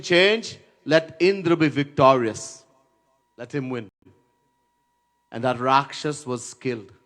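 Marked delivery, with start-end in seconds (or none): none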